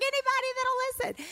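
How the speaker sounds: very high frantic husband impression; mock panic. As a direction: imitate a panicked dad crying out for help. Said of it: "he panics".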